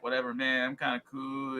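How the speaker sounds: low, laid-back surfer dude; lazy beach-bum surfer voice. Retold or imitated. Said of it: "surfer voice".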